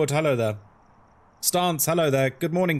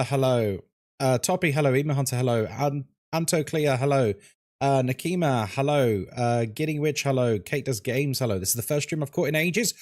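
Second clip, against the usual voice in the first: usual speaking voice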